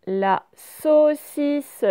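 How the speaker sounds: LA SAUCISSE loud, high and very slow; teacher sounding out SAUCISSE; sounded out slowly. Shown as a demonstration. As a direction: stretch SAUCISSE as SAU-CI-SSE. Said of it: "on va prendre mot par mot".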